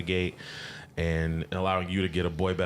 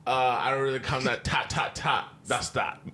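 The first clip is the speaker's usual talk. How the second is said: mock London accent; 6 semitones higher than usual. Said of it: "Don't really play no tic-tac-toe".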